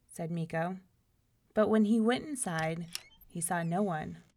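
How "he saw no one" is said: ordinary delivery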